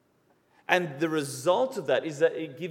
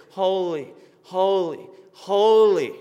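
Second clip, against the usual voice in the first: HOLY three times, each louder, building to a big last one; emphatic repetition; 2 semitones higher, 7 dB louder, slower than usual. Retold or imitated. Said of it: "they raise something to the third degree".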